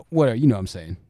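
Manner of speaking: ordinary talk, nothing marked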